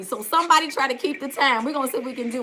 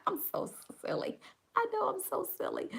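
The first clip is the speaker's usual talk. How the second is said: giggly whisper; 9 semitones higher, 9 dB quieter than usual. Real, not acted. Giggling at herself.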